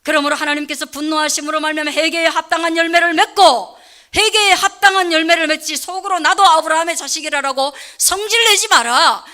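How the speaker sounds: loud, soaring preacher's climax; full-force preaching. Acted. Pastor pressing the congregation at the sermon's climax. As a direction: drive the point home at full voice.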